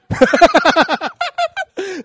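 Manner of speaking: a real, high laugh bursting out; high burst of laughter. Real, not acted. Preacher laughing at his own self-pity joke, high real laugh.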